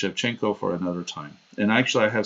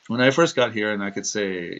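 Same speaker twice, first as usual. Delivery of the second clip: ordinary talk, nothing marked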